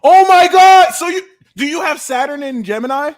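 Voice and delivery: very high, loud excited squeal; excited shout. Real, not acted. Hearing the birth year, very high excited shout. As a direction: shriek with delight. Astrology reveal excites him.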